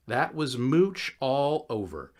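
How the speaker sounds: usual speaking voice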